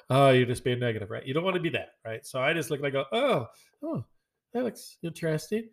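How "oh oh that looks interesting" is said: high, overly polite fake enthusiasm; fake polite interest. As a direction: hide the disgust behind forced politeness. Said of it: "Trying to throw the quote out there".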